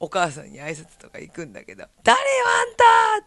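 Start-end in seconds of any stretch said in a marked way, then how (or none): 2.0-3.3 angry shout. Retold or imitated. Mother yells at stranger.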